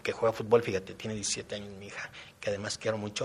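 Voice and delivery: normal talking voice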